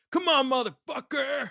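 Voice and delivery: high, loud, slow mocking yell of a furious woman; imitating an angry driver yelling from the street; furious street challenge. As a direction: bellow it like she's squaring up.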